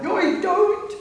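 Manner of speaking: high, loud huntsman's holler; a huntsman cheering his hounds on, loud and high; clear hunting cry. A narrator's reading. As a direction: ring it out to lift the hounds. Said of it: "And a clear".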